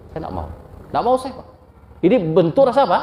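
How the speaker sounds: normal talking voice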